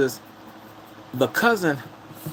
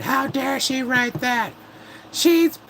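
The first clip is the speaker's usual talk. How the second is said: high, slow, hammed-up indignation; drawn-out pretend fury, pitched way up; mock outrage; 11 semitones higher, much slower than usual. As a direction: parody a scandalized critic in a shrill squawk. Imagines an outraged online critic.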